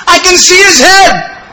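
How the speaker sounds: high, slow, excited cry; thrilled shout. Retold or imitated. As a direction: yell it out in awe, drawn out. Soldier thrilled, retold again.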